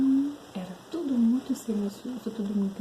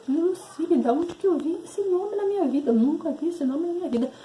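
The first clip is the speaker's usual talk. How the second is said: astonished; 8 semitones higher, 3 dB louder than usual. Retold or imitated. Strange name pops into head.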